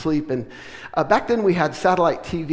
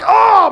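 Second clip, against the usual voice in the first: exasperated groan; 13 dB louder than usual. Retold or imitated. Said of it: "I'm like".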